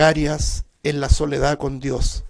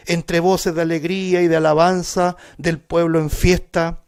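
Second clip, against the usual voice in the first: louder preaching read of the psalm; fervent scripture reading; 2 semitones higher, 6 dB louder than usual. Acted. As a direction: proclaim the psalm to the congregation. Reads psalm aloud in sermon.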